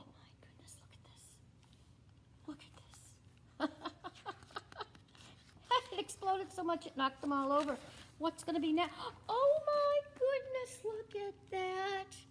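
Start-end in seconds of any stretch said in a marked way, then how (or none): 0.0-3.0 whisper. Real, not acted. Whispered amazement at the screen. 9.3-12.2 high, slow amazed oh my goodness; watching the paint explode in amazement; amazed exclaim. Real, not acted.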